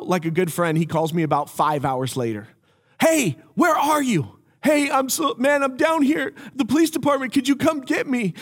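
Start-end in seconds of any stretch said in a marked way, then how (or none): 3.0-4.4 frantic friend. Retold or imitated. 4.6-8.3 mock frantic. Retold phone exchange.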